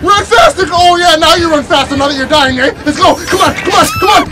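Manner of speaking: high loud taunting yells; hyped shouting. Real, not acted. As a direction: taunt the killer at full volume. Taunting killer during escape.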